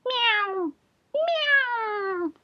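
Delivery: very high, long meows; crying cat. A narrator's reading. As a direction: wail like a shut-out cat. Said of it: "cried Simpkin".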